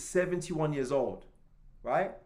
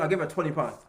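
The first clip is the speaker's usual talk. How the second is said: ordinary talk, nothing marked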